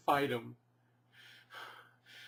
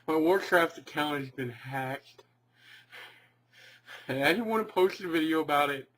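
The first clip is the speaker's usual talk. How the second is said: ordinary talk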